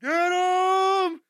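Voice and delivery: high, full-voiced hammed-up shout; over-the-top comic bellow; mock furious yell. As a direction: scream the catchphrase, stretched out, until it cracks into laughter. Climax of mock insult rant.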